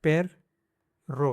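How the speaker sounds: पेर then रो with a break for a rolled rr; divided syllables. Shown as a demonstration. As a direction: split पेर रो in two. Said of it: "इसको दो sound आएगा". ("पेर रो" दो हिस्सों में तोड़कर)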